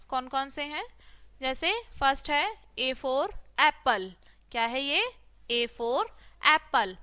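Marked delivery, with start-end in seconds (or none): none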